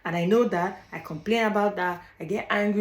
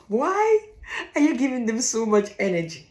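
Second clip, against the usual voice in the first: exasperated outburst; 4 semitones higher, 4 dB louder than usual. Retold or imitated. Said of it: "I'll be like".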